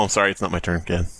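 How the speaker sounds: usual speaking voice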